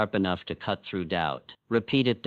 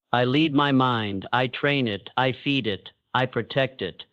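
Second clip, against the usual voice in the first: ordinary talk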